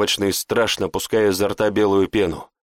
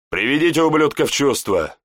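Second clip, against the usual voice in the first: barking order; 5 semitones higher than usual. A narrator's reading. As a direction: bark it at the soldiers. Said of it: "рявкнул Рамилио".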